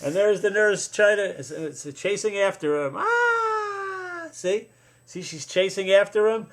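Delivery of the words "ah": high drawn-out play scream; mock scream. Nurse's scream while chasing.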